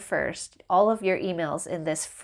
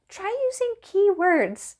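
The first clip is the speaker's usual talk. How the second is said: much higher, slow sing-song bot; slow, high, mocking bot voice; mock chatbot; 12 semitones higher than usual. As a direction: drag out the bot's canned advice. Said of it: "it was like".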